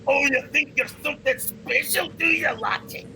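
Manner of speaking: high mocking taunt; dwarf taunting the player before arm wrestling. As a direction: sneer at him, sizing him up. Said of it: "he says".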